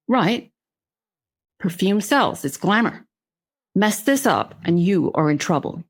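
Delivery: lightly voiced Southern boss; Southern accent. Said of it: "He came out very Southern".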